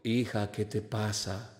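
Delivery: low, soft, slow fatherly God; God's voice. Acted. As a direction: ask calmly, deep and unhurried. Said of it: "vino Dios y le dijo".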